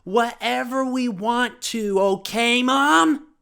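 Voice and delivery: very high, loud, slow kiddie shriek; stretched-out mock tantrum screaming; stressed-out kid. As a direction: yell at mom in a full childish tantrum. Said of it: "And I'm all stressed".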